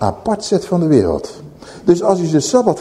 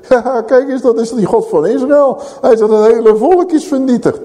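higher, laughing mockery of a helpless god; mocking nations; 7 semitones higher than usual. Heathen nations scoffing at Israel's God, mocking laughter. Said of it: "Wat zouden de volkeren zeggen?".

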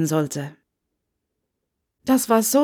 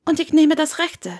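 lighter, higher voice for Hermione, polite; young Hermione voice; 8 semitones higher than usual. A narrator's reading. Hermione at the cottage door, lifted young voice.

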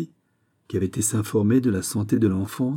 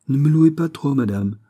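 higher, louder start with a touch of worry; uneasy protest; 5 semitones higher, 5 dB louder than usual. A narrator's reading. Duchess to the queen who praises her, uneasy. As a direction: plead lightly, a little uneasy. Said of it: "inquiète".

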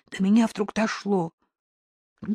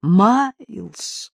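loud, drawn-out cry of a name; louder call; 8 dB louder than usual. A narrator's reading. Owners searching for lost Miles, louder cry. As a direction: call out louder, voice carrying closer. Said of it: "уже чуть громче".

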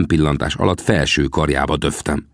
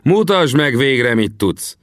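raised, slower dare, dropping at the end; mildly shouted taunt; shouted challenge; 8 semitones higher, slower than usual. A narrator's reading. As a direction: goad the braggart into acting. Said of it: "kiáltottam vissza".

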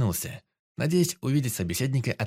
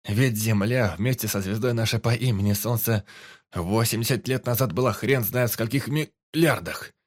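ordinary delivery, nothing marked

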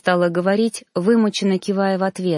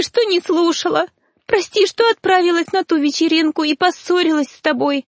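much higher, tearful, breaking apology; trembling sobbing; 7 semitones higher than usual. A narrator's reading. Daughter to her mother, sobbing apology. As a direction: pour out guilt through sobs. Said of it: "Её голос дрожал".

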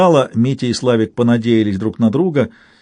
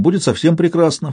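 plain reading voice

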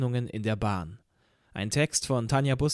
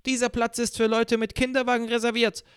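bellowing fury; 10 semitones higher than usual. A narrator's reading. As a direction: roar it at him in a high angry voice. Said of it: "brüllte die Furie".

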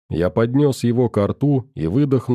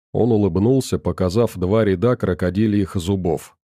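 ordinary delivery, nothing marked

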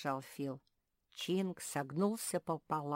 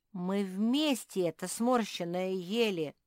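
raised, loud protest; indignant; 4 semitones higher, 7 dB louder than usual. A narrator's reading. Squirrel sharing the blame with bear, indignant. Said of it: "возмутился Бельчонок".

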